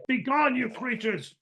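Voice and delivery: high-pitched mock cry of banishment; friar banishing creatures. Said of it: "And he's like".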